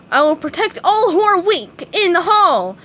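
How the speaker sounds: high, loud grand proclamation; heroic shout. A narrator's reading. SpongeBob proclaims to the class, loud and high. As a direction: declare it like a superhero. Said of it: "SpongeBob shouted".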